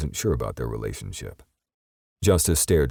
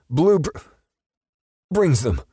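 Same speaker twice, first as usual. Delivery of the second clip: much higher, loud broken stammer; terrified stammer; 6 dB louder than usual. A narrator's reading. Perp stammering under the gun, high and frightened. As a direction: choke out the words in terror.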